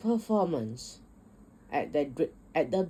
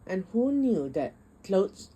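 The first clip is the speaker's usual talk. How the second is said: plain reading voice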